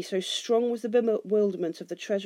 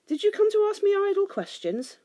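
high-pitched scornful question; indignant challenge; 13 semitones higher, 4 dB louder than usual. A narrator's reading. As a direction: demand it impatiently, pitched up at the start. Thorin scorns the envoys.

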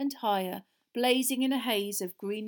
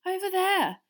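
high, louder, frightened reply; shaky; 10 semitones higher, 6 dB louder than usual. A narrator's reading. Hermione pointing to the voice's place, shaky answer. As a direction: answer nervously, pitched up. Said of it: "said Hermione shakily".

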